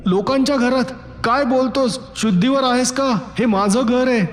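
high, incredulous furious yell; outraged shout. A narrator's reading. Husband shouting that this is his own house, outraged. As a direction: shout him down in disbelief. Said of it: "मी परत ओरडलो".

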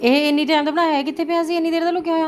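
high, loud amazed questions; surprised. Real, not acted. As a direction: sound truly astonished. Surprised at old note found.

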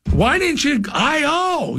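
high, slow, mock-pleading call; retold challenge. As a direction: imitate a fan demanding the answering chant. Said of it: "He said".

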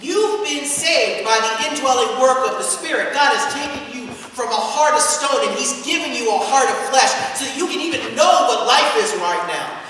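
usual speaking voice

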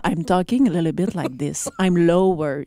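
low-pitched, settled English. Shown as a demonstration. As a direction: drop into a low register when speaking English. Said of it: "Je parle plus bas".

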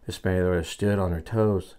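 ordinary delivery

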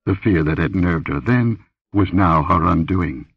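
ordinary delivery

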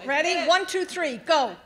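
loud, high count-in to a crowd; rallying countdown. Real, not acted. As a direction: call out the cue for everyone to shout. Cueing graduates to shout together.

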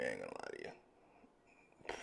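low whispered aside to the viewer; whispered confession. Real, not acted. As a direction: confide it under your breath. Confiding aside.

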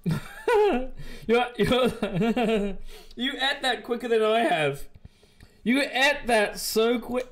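high-pitched, breaking up with laughter; real amused teasing, cracking up mid-sentence; laughing. Real, not acted. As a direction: laugh helplessly through the words. Host cracks up at Liz eating.

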